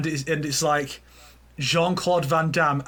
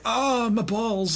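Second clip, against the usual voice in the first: higher, drawn-out cry of pain; mock pained groan; 5 semitones higher, much slower than usual. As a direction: groan it in hammy agony. Acts villain punched in the groin.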